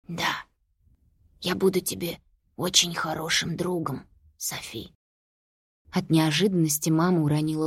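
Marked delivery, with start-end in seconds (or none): 0.1-4.9 soft hoarse creature voice. A narrator's reading. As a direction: rasp it out, barely voiced. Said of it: "произнесло сиплым голосом".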